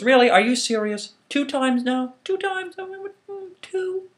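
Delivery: climbing indignation at a second soaking; incredulous outrage. Acted. Obatala to Eshu, oil spilled twice, incredulous and outraged.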